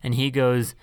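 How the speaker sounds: usual speaking voice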